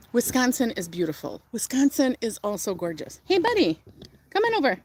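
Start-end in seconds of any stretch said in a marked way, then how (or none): 3.2-4.8 high sing-song call to a pet; friendly high call to a dog. Real, not acted. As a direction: coax the dog over sweetly. Calling her dog over.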